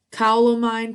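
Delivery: loud high call to the cow. Acted. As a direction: call out across the field. Witch calls out to cow.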